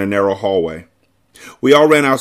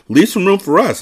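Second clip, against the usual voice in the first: higher, urgent exclamation; to Claudia, a quick pitched-up protest; exclaiming; 9 semitones higher than usual. A narrator's reading. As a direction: call out to stop her filling the closet. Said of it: "I exclaimed".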